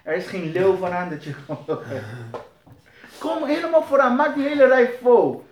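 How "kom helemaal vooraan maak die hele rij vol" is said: high, loud call to come forward; rally them to the front row; rousing call. Real, not acted. Preacher calling the church to fill the front row, loud and high.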